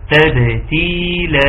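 drawn-out تبتيلا, second ت fully in i; clear kasra. Shown as a demonstration. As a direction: hold the long vowels of تبتيلا slowly. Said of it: "كسر التاء الثانية". (تحقيق كسر التاء الثانية في «تبتيلا» ببطء)

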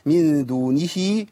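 "من دونه" slow, ending in a drawn-out ee; long connecting vowel. Shown as a demonstration. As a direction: stretch the vowel after the H of "دونه". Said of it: "عندي صلة هنا". ("من دونه" بإثبات ياء الصلة)

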